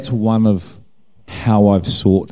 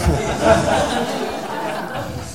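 airy laugh; 6 dB quieter than usual. Real, not acted. Laughs at own sniffling.

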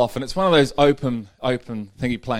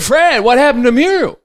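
surprised pilot; 12 semitones higher, 10 dB louder than usual. Acted. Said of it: "He said".

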